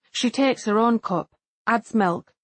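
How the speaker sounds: usual speaking voice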